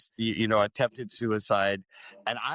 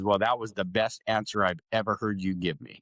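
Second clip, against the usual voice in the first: normal talking voice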